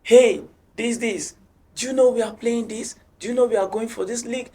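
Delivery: loud, high imitation of a shouting coach; coach's shout. Coach to players who seem down, shouting. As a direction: call out sharply, acting the coach. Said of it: "He will, like, shout".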